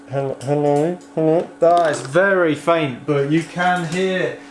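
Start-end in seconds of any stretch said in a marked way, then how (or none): none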